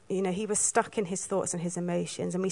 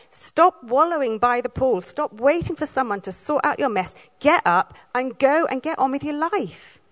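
louder, firm push to get moving; stern telling-off; 4 semitones higher, 4 dB louder than usual. Shown as a demonstration. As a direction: snap him out of his wallowing. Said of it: "add that bit of tone".

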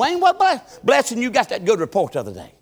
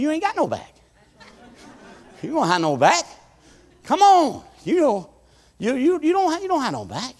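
lightly voiced stern father, teasing; a touch of stern daddy; 7 semitones higher than usual. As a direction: brush off the boy's complaint. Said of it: "He said".

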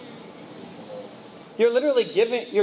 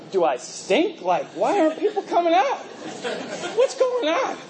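frustrated wondering; 7 semitones higher than usual. Retold or imitated. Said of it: "And I'm like".

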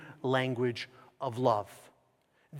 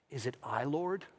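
soft question, rising at the end; hushed question; 2 semitones lower, 9 dB quieter than usual. Retold or imitated. Disciples ask Jesus at the Last Supper, hushed question. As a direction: ask it quietly and tentatively.

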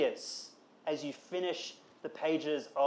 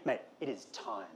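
softer, lower, weighty; solemn best man; 6 semitones lower, 9 dB quieter than usual. Retold or imitated. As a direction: say it like the big moment has come. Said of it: "the best man coming to the groom and saying".

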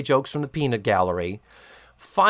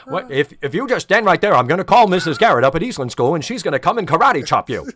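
put-on loud, fast childish threat; mock kid threat; 2 semitones higher, 7 dB louder, faster than usual. Kid threatening a rival with his karate teacher, loud and fast. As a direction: rattle it off like a bragging kid. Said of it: "You know".

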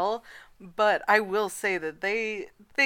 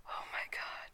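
whisper; 16 dB quieter than usual. Real, not acted. Ominous disclaimer shocks her.

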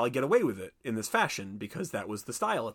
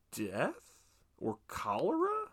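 mock quiz question; 7 dB quieter, slower than usual. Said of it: "I was like".

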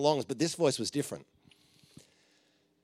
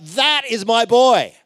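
high, slow, full-throated shout of a proud father; a father who can't hold back his pride, yelling; joyful yell; 9 semitones higher, 11 dB louder, slower than usual. Acted. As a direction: bellow it out with delight. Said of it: "yells out".

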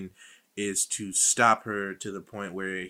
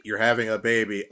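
ordinary talk, nothing marked

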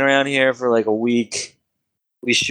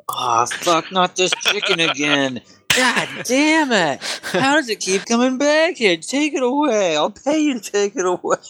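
grumbling wizard rising to high, annoyed pleading; a fed-up wizard begging them to take the chicken away; exasperated wizard; 9 semitones higher than usual. Acted. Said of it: "He goes".

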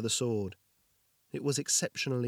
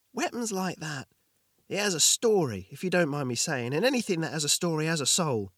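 earnest blurt; 5 semitones higher, 4 dB louder than usual. Acted. Jorgin blurting a thought.

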